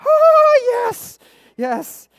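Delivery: hammed-up, high, loud whoop of victory; triumphant cry. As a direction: let out a huge soaring "oh", then settle into yeses. Retells triumph at roaring bonfire.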